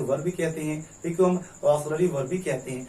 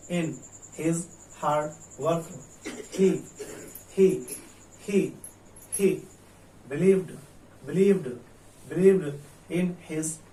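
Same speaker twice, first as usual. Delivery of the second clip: word-by-word drill; much slower than usual. Shown as a demonstration. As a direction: break it up for the class. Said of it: "तीसरा sentence देखिए". (टुकड़ों में अभ्यास)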